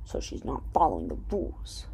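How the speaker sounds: hushed conspiratorial whisper; whispered aside. Real, not acted. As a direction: drop to a whisper, sharing a secret. Aside about Susan breaking rules.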